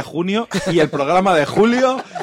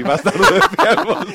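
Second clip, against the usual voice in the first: put-on Italian accent; 4 semitones higher, 3 dB louder, slower than usual. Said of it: "acento italiano".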